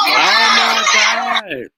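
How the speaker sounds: slow, loud stunned gasp of words; drawn out in disbelief; loud, drawn-out oh my God; real shock. Real, not acted. Shocked reaction to violent clip.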